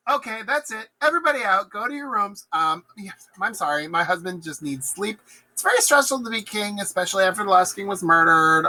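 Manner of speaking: flustered hostess. Acted. Lady Macbeth clearing out the feast, high flustered hostess voice. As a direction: shoo the guests while covering up.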